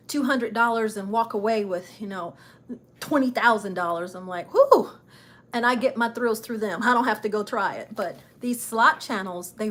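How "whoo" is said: high, loud "whoo"; loud high-pitched cheer; excited whoop. Retold or imitated. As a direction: let out a short thrilled whoop. Voices own thrill at big wins.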